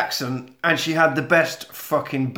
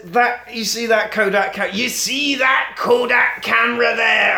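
high, indignant mock shout; irate old man; 7 semitones higher than usual. Jack points out his camera, acted indignant shout. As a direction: raise the pitch in hammed-up outrage.

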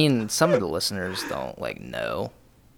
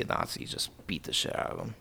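whispered aside; 5 semitones lower, 14 dB quieter, faster than usual. Real, not acted. Joking advice on punching Nazis.

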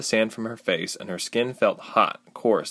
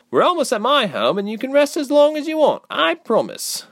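very high, louder urging; encouraging girl; 5 dB louder than usual. A narrator's reading. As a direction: reassure and push her onward, pitched up. Girl urges Ilse onward.

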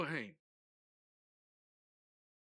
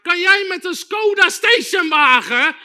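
loud, high preaching that builds; fervent challenge; 8 semitones higher, 12 dB louder than usual. Real, not acted. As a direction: drive the challenge home at the top of your voice. Preacher's climactic challenge to listeners.

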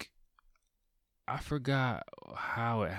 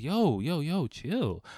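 high-pitched plea to back off; urgent calming down; 8 semitones higher, slower than usual. Retold or imitated. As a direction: rein in an overheated friend. Retold attempt to calm friend down.

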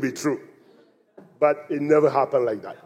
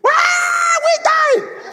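much higher shrieking cry of terror; high panicked scream of drowning people; much slower than usual. Retold or imitated. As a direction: scream like the boat is going under. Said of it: "people start screaming".

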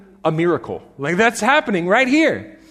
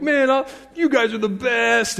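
mildly excited imitation of a fan, pitched higher; 5 semitones higher than usual. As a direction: gush to your fellow fans, lightly acted. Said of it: "and we're excited".

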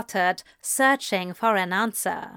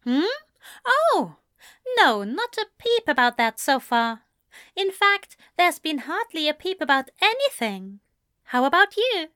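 higher-pitched fussy angel, making polite small talk; prim angel character; 5 semitones higher than usual. Acted. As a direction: play a proper angel, voice lifted above the narration. Voices Aziraphale in dialogue.

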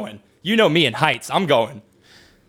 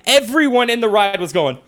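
ordinary delivery

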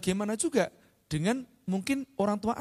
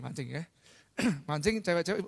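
usual speaking voice

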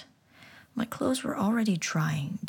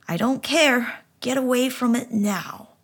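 stern order; 6 semitones higher, 7 dB louder than usual. Acted. Nana ordering him away.

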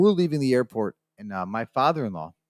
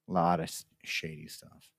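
much softer, dropping to a near whisper; hushed aside; 4 semitones lower, 8 dB quieter than usual. Real, not acted. Traveller confiding about shady airport dealings, hushed. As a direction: repeat it under your breath, confidentially. Repeats the remark under his breath.

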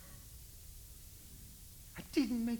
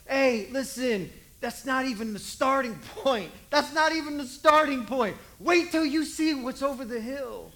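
eager calling out; 5 semitones higher, 9 dB louder than usual. Acted. Graduate Jesus calling back.

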